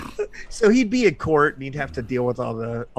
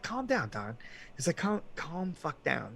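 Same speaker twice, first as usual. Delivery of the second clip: much softer, placating mock voice; hushed calming; 4 semitones higher, 12 dB quieter than usual. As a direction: act out quietly talking a friend down. King calming angry Don.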